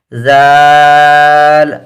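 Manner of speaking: زال with a long stretched aa; زال recited slow and long; drawn-out letter name. Shown as a demonstration. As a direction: hold the aa in زال. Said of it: "اس کو بھی تھوڑا سا کھینچ کے".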